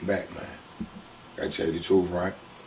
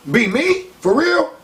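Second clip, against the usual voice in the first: high, loud disbelieving protest; outraged disbelief, pitched way up; getting mad; 4 dB louder, much slower than usual. Retold or imitated. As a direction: worker can't believe the boss turned on him. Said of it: "Now I'm getting mad".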